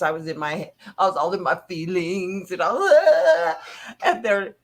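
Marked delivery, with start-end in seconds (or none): 2.9-4.0 laughing and crying at once; a wet, tearful laugh; half laugh, half sob; laugh breaking into cry. Real, not acted. Laughing through tears.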